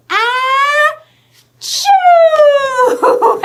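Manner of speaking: high, loud, hammed-up sneeze; big sneeze. The bear sneezing in the story, loud hammed-up sneeze.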